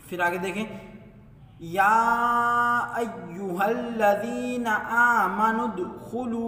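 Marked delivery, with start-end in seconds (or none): 1.6-6.5 slow, drawn-out tajweed chant; chanted Quranic recitation. Shown as a demonstration. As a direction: recite melodically, stretching the vowels. Recites verse.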